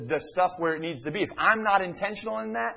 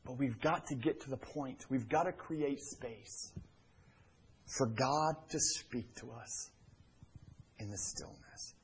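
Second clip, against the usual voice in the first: hushed; 4 semitones lower, 13 dB quieter than usual. Acted. Sermon on God's whisper.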